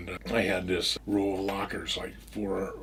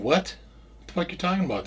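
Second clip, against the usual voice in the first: retold startled disbelief, voice jumping higher; higher-pitched, disbelieving questioning; incredulous; 8 semitones higher, faster than usual. Man reacts to task force news, incredulous.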